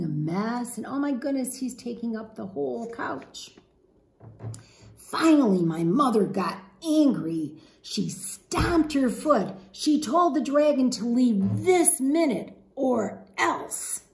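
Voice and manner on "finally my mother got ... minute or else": lively, expressive storybook read-aloud; animated picture-book narration; reading to children. Acted. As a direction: read it to a class of young kids.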